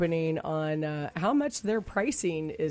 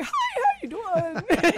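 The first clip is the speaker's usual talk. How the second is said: high squeaky voice; 3 dB louder, slower than usual. Retold or imitated. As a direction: squeak it out with no voice left. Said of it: "Squeak, squeak".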